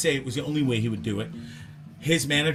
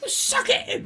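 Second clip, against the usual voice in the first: very high squawky English parrot voice; mimicking parrots; slower than usual. Zoo parrots copying each other's swearing for laughs, high squawky mimicry. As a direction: squawk like a chorus of cheeky parrots. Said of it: "all the parrots would start going".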